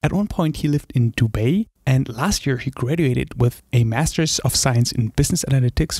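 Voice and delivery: upbeat host reading a prepared intro; host's scripted welcome over music; podcast intro read. Acted. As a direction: announce the show like a radio host.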